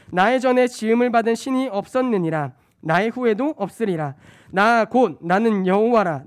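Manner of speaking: loud, declaiming preaching voice; booming Isaiah reading; scripture reading. Acted. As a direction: proclaim God's words to the church. Reads Isaiah aloud.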